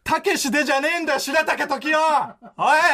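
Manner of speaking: quick indignant comeback; comic angry rebuttal; performed anger. Acted. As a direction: throw the request back in mock outrage. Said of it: "切れる方法をさ".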